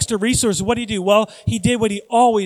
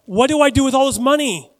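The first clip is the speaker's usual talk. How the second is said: loud, high mock dilemma; rich man's question; 7 semitones higher, 7 dB louder than usual. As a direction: lightly act a rich man at a loss. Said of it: "It was like Scrooge McDuck".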